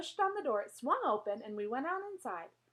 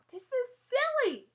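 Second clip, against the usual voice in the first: high, loud shout over the noise; 13 semitones higher, 6 dB louder than usual. Acted. Girl shouting over the barking dog. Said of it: "I shouted over Winn-Dixie barking".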